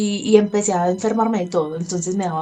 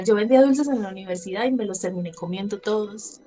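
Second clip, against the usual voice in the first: ordinary talk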